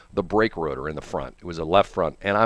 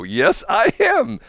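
amused high reply; 13 semitones higher, 5 dB louder, much slower than usual. Retold or imitated. Answering dispatcher, amused.

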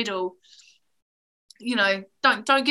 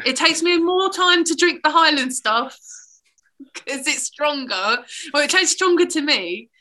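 louder, higher lively talk; animated; 5 semitones higher, 6 dB louder than usual. Real, not acted. Telling her friend which whisky she struggles with, louder and higher. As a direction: get worked up about whisky.

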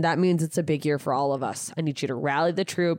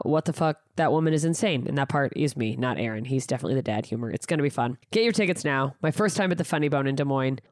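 ordinary delivery

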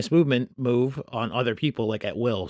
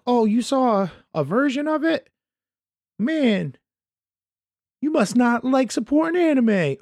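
much higher, whiny mock voice; snooty fan impression; 11 semitones higher than usual. Host imitating fans who scold leak-watchers, whiny and high. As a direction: parody a preachy know-it-all. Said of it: "all the people who are like".

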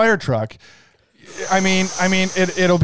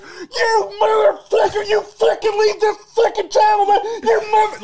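acted screaming. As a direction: play someone screaming curses in falsetto. Caller screaming at intruder, acted.

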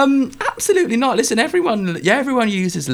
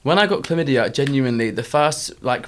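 ordinary talk, nothing marked

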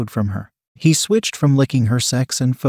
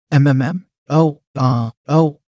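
moaning; 3 dB louder than usual. A narrator's reading. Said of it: "They continued to kiss and moan".

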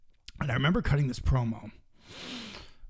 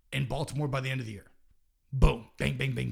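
usual speaking voice

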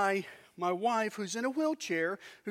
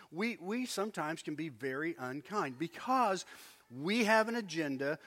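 ordinary delivery

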